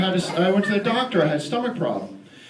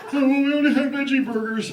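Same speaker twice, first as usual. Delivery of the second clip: snooty clerk; 7 semitones higher than usual. Retold or imitated. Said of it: "this fucking dick behind the counter goes".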